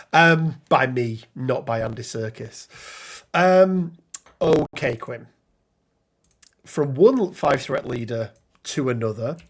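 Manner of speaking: ordinary talk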